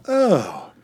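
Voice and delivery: slow, lightly put-on Italian sigh of relief; a touch of a bad Italian accent; fake Italian accent. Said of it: "a shitty fake Italian accent".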